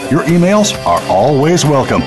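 ordinary talk

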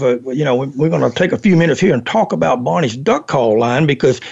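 usual speaking voice

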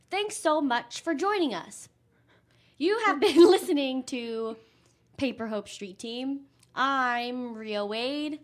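put-on formal old radio sign-off; old-fashioned announcer. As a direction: read the card like a vintage announcer. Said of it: "Should I read this card old-fashioned?".

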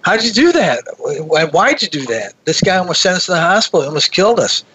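retold incredulous outburst; retold yell. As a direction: exclaim in disbelief, pitch jumping up. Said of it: "I sort of yell out".

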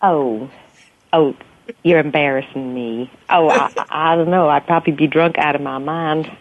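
coy Southern belle, American South accent. Shown as a demonstration. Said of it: "How would she sound if she was a Southern belle?".

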